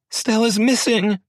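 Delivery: high, loud, slow panicked scream. A narrator's reading. Wife screams at husband that their baby is missing, screaming. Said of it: "she screamed".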